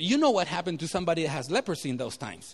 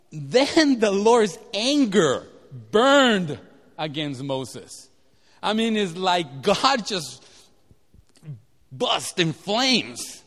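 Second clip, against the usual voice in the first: loud, very slow, every word weighted; slow it right down for God's anger; dramatic slow telling; 5 dB louder, slower than usual. Acted. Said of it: "dramatic telling of God's anger".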